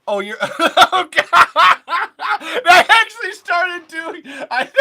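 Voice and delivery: loud, high helpless laughter; squealing with laughter at his own mistake; cracking up. Real, not acted. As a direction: talk through a real fit of laughing.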